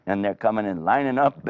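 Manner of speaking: usual speaking voice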